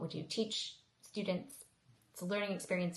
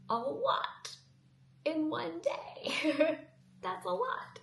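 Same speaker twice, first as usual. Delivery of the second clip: exasperated laugh; 10 semitones higher, much slower than usual. Real, not acted. Overwhelmed by busy schedule.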